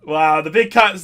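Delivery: ordinary talk, nothing marked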